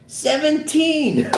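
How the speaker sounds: high, drawn-out exclamation; long high-pitched whoop; amazed hoot. Acted. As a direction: crow the number slowly in disbelief. Officer hoots at the age.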